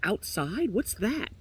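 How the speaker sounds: high, puzzled questions; high-voiced baffled little bird; wondering canary. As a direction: ask in innocent wonder, pitched high. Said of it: "the canary wonders".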